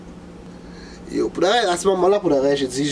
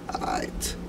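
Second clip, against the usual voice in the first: whisper; 11 dB quieter than usual. Retold or imitated. Shushing the tow truck driver.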